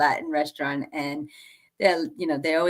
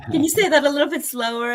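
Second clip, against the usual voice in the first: laughing; 8 semitones higher, faster than usual. Retold or imitated. Asking waiter to slow down.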